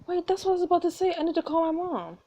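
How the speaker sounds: high-pitched surprised protest; interrupting character. Acted. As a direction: cut in with a high voice. Another character interrupts, startled.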